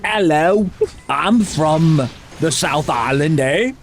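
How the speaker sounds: mock South Island New Zealand accent, drawn out; hammed-up Kiwi accent ending on a questioning lift; badly faked New Zealand accent, slow and stretched. Shown as a demonstration. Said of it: "How do they say hello?".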